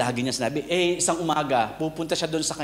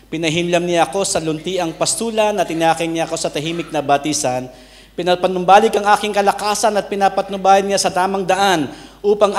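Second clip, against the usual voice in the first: scripture reading; 3 semitones higher, 6 dB louder than usual. Acted. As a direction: read the verse aloud to the congregation. Reading Psalm aloud. (taimtim na pagbasa ng Banal na Kasulatan)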